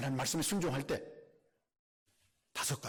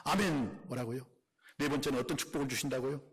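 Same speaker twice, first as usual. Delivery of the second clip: usual speaking voice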